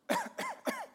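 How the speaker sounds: loud high mock cough to get attention; pointed fake cough. Martha coughing loudly at Mary. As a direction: cough on purpose so she notices. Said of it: "Maybe she coughed a little loudly".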